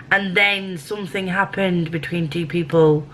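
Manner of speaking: ordinary delivery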